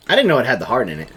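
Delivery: ordinary delivery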